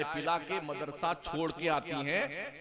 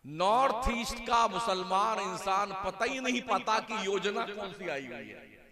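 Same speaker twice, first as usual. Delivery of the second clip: ordinary talk, nothing marked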